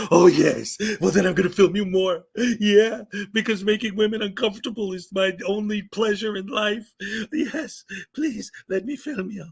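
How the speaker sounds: mock ecstatic creep; gloat, then plead eagerly; leering auditor. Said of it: "I was doing my".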